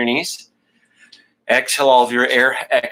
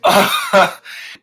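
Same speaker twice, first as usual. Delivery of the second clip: loud sigh; 9 semitones higher, 5 dB louder than usual. Real, not acted. Exhaling hard during the exercise.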